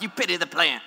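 a light Mister T tough-guy take; God delivering it like Mister T's catchphrase; Mister T-style line. Retold or imitated. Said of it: "I'm just picturing almost like, Mister T".

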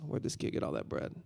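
hushed, unvoiced mock whisper; whispered aside. Disciples walking up to a kid with bread, whispering. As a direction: whisper it like a secret between friends.

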